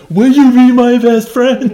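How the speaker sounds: high, loud, slow gushing plea; eager little girl. Retold or imitated. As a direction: beg for a best friend, wide-eyed. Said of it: "she's like".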